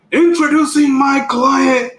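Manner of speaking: slow, high announcer introduction; hammed-up hype-man announcement; wrestling manager. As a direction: proclaim it like a ringside manager. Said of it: "I should have been a manager".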